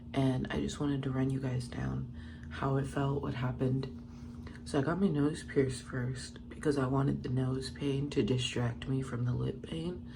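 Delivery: usual speaking voice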